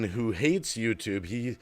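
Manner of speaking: usual speaking voice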